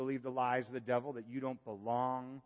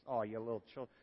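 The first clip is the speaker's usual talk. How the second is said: put-on southern accent; 7 semitones higher than usual. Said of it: "I almost hear a southern accent".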